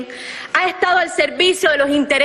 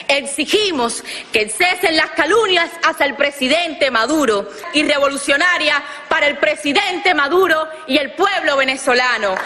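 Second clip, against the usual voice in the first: normal talking voice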